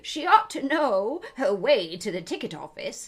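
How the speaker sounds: loud, high, bleating goat. Acted. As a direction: goat loudly scolds Alice about the ticket office. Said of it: "said in a loud voice".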